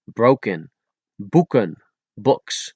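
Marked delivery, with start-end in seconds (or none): 1.2-1.7 Boeken with K free of any puff; unblown K. Shown as a demonstration. Teacher showing Dutch letters, Boeken with unblown K. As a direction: say Boeken in Dutch, K cut short. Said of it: "without blowing those three letters".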